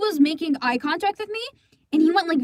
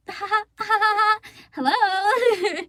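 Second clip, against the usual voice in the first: awkward laugh hello; 7 semitones higher, slower than usual. Retold or imitated. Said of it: "I was like".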